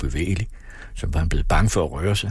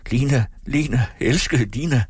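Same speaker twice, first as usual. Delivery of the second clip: higher-pitched, beseeching call; pleading call; 7 semitones higher than usual. A narrator's reading. Husband calls to his beloved fainted wife, pleading. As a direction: plead for her to wake.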